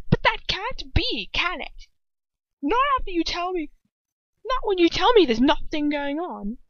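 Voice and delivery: high, heated accusation; voice rising. A narrator's reading. Ron walking over to confront Hermione, voice rising. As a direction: raise your voice in angry disbelief. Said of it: "his voice raising".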